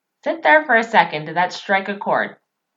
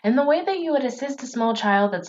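ordinary talk

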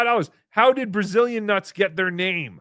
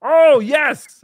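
loud, high exclamation; shouted show of recognition; mock realization; 7 semitones higher, 11 dB louder than usual. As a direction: burst out as if suddenly remembering. Mock sudden remembering.

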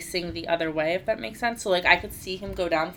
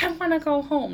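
put-on higher, whining little kid; whiny child; 10 semitones higher than usual. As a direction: whine it like a tired five-year-old. He's like.

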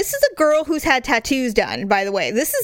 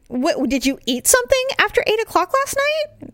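incredulous question; 11 semitones higher than usual. Retold or imitated. Wife questioning husband about eating late, incredulous. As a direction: pitch climbing high in mock disbelief.

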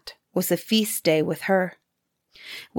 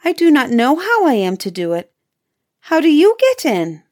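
put-on high little girl voice, rising higher; feigned innocent girl; 6 semitones higher, 4 dB louder than usual. A narrator's reading. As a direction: play dumb with wide-eyed questions. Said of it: "Gretel saw what she had in her mind and said".

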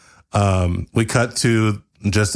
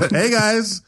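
YouTuber greeting; 12 semitones higher than usual. Acted. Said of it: "everybody in the room goes".

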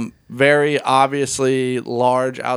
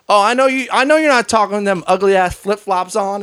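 high, loud mock insult yell; retold screaming; 6 semitones higher, 3 dB louder than usual. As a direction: imitate a passenger yelling insults across the plane. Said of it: "screaming at each other".

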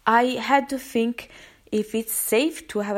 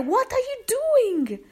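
high, drawn-out shocked cry; retold scream; 11 semitones higher, 3 dB louder, slower than usual. Shocked witness crying out at woman who kicked dog, retold scream. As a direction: yell it high, stretching the words in disbelief. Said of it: "I scream at her".

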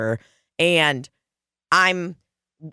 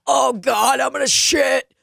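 panicked outburst; 9 semitones higher than usual. Retold or imitated. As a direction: cry out in sudden bathroom panic. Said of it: "And I was like".

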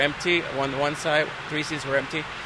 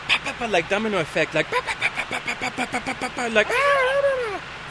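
imitated commotion; 7 semitones higher than usual. Imitating commotion spreading through cabin.

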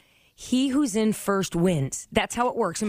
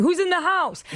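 higher, paranoid mock cry down the stairs; mock paranoid call; 8 semitones higher than usual. Host retelling a stoned scare, a paranoid call. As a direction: act spooked after an edible. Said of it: "I was at the top of my stairs going".

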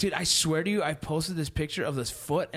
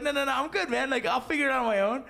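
mock refusal; 10 semitones higher than usual. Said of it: "they're like".

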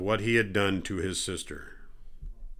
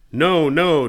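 louder, higher protest; distressed cry; 5 semitones higher, 7 dB louder than usual. A narrator's reading. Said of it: "Tamar cried".

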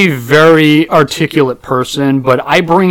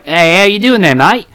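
higher, cheery put-on Australian greeting; Australian accent; 4 semitones higher than usual. Worker fools an Australian customer, put-on Australian accent. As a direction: greet like a slightly rusty fake Australian. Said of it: "haven't done an Australian accent".

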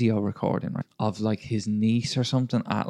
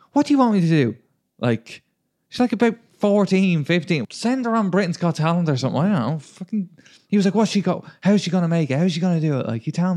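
heated retelling; 7 semitones higher, 3 dB louder than usual. Retold or imitated. Retold quarrel with Uber driver.

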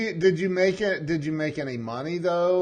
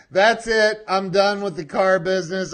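louder, higher, playful declaration of quitting; mock resolve; 6 semitones higher, 7 dB louder than usual. Host voicing the fired salesman's decision, mock-dramatic resolve. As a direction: declare it grandly, teasing.

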